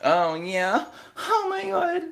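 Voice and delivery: hammed-up high moaning, stretched out; mock moaning. Mocking the friend's sex noises with high moans. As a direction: moan it like a mock lover, way up high.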